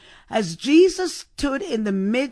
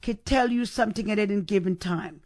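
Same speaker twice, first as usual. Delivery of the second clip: ordinary talk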